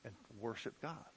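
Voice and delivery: normal talking voice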